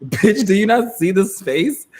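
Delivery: high, slow, sassy clapback; slow sassy comeback at the rude woman; sassy retort. Retold or imitated. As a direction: throw attitude at her. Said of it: "I literally go".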